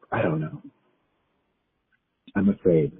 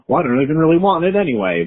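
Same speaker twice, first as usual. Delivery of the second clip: fast, higher, louder dismissive inner voice; dismissive ego voice; 6 semitones higher, 4 dB louder, faster than usual. Retold or imitated. Nervous before an interview, voicing her ego, dismissive. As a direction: brush it off like a sulky ego. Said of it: "voice comes up".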